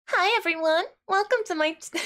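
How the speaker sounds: high, bright VTuber greeting breaking into a laugh; sugary VTuber voice. Shown as a demonstration. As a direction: chirp it like a cutesy streamer. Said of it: "Can I try".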